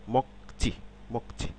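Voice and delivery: মকচি with a tense ch; মকচি, hard ch after k. Shown as a demonstration. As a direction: stop the k in মকচি, then say chi. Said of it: "চয়ের মতো".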